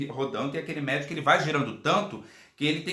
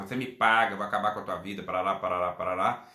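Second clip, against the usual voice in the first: grumbling threat; 5 semitones lower, faster than usual. Retold or imitated. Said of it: "Ele começou a resmungar".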